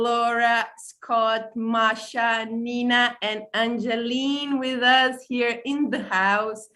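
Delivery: usual speaking voice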